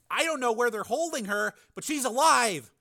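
high, pleading insistence; urgent hope. A narrator's reading. Frank urging a rescue, hopeful pleading. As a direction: urge him to believe it.

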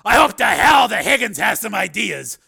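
high, loud, exasperated declaration; Steele, exasperated. Acted. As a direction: throw it out forcefully, at wits' end. Steele out of ideas.

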